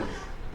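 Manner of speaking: quick amused chuckle; a brief real laugh; short laugh. Real, not acted. Laughs at his cake topper joke.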